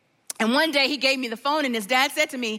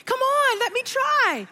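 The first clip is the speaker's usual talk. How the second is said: lightly voiced young boy, pitched higher, pleading; a hint of a kid pleading with his mom; son at nine; 8 semitones higher than usual. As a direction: play the eager nine-year-old begging to try. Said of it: "my son's like".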